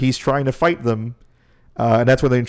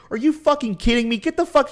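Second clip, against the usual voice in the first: much higher, exasperated yell; furious retort; 14 semitones higher than usual. Retold or imitated. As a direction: snap back in disbelief. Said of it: "He goes".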